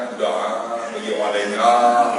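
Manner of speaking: acted subdued murmur after crying; subdued, spent voice. Acts out the spent, moved man.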